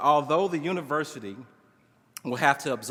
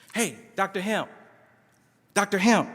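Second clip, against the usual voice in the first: calling out, higher and louder on the second Doctor Hemp; shouted greeting; 6 semitones higher, 5 dB louder than usual. A narrator's reading. Student calling his professor across campus, shouting. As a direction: holler after him across the quad. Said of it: "I hear being shouted behind me".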